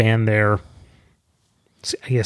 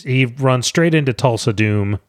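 ordinary delivery

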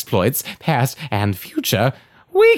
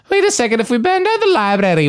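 high, fast realization; sudden realization; 8 semitones higher, much faster than usual. Acted. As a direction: catch yourself, pitch up fast.

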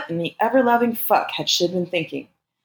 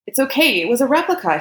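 higher-pitched reassurance; hasty placating; 9 semitones higher than usual. A narrator's reading. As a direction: soothe quickly, voice pitched up. Man placates frightened lover.

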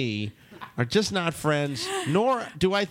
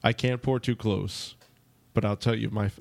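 lightly voiced presidential cadence, lower and halting; Obama impression; 5 semitones lower than usual. Host serving shots to ladies, mild Obama impression. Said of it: "I'm gonna do it like Obama".

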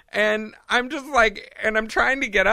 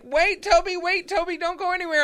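retold yell at dog; 11 semitones higher than usual. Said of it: "I'm yelling at the, Toby".

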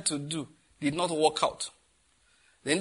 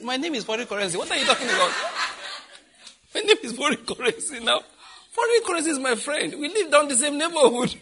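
higher, louder, laughing joke; excited mock boast; 10 semitones higher, 5 dB louder than usual. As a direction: ham up the delighted retort. Friend's joking retort about currency.

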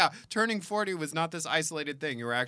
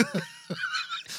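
squealing laugh; 4 dB quieter than usual. Real, not acted. Laughs at joke.

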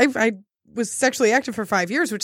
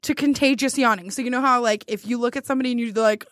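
ordinary talk

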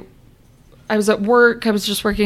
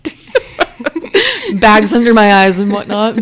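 laughing; 6 dB louder, slower than usual. Retold or imitated. Sister's teasing quote, laughing.

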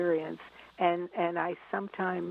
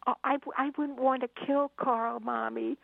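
put-on earnest child; 7 semitones higher than usual. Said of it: "looked up at me earnestly".

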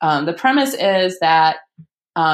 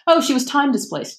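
airy, higher-pitched offhand mention; imitating a breezy, casual aside; nonchalant; 8 semitones higher than usual. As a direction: toss it off as no big deal. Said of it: "like, nonchalantly".

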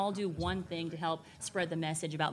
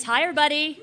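calling out; 8 semitones higher, 7 dB louder, faster than usual. Real, not acted. Greeting live audience.

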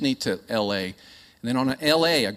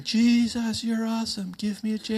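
mock cheering crowd; 8 semitones higher than usual. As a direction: imitate a cheering fan at half volume. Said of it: "everybody's cheering".

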